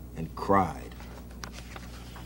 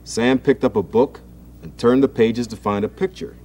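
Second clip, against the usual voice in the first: ordinary delivery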